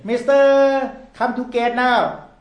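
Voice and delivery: loud, high call, stretching out the title; gate announcer. Acted. Airport staff calling a passenger who forgot the gate, loud announcing voice. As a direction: summon a late passenger loudly. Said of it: "And then they say".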